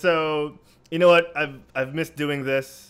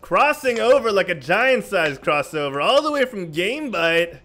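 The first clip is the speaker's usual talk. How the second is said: big booming host intro; announcer hype; 5 semitones higher, 5 dB louder, slower than usual. Acted. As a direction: hype up the guest like a wrestling announcer. Hyped guest introduction.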